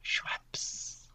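breathy whisper; whispered reveal. Pretend. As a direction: whisper the brand name like a secret. Dramatic reveal of brand.

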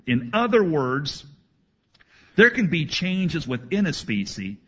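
normal talking voice

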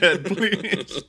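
high pleading through laughter; mock begging. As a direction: beg while cracking up. Victim begging villain.

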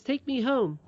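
high-pitched little princess pleading; pleading cry. Acted. Princess begging her nurse, pleading. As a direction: plead like an upset child. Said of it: "cried the princess".

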